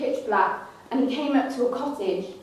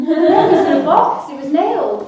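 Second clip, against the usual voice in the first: louder, said through laughter; laughing; 5 semitones higher, 8 dB louder than usual. Real, not acted. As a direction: giggle through it. Laughing at awkward reveal.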